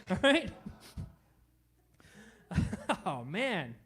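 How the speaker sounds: ordinary talk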